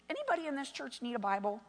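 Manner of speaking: ordinary talk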